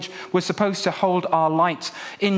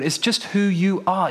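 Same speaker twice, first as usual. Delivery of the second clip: normal talking voice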